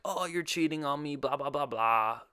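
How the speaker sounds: hammed-up complaint trailing into blahs; mock accusation. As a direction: whine out a pretend accusation.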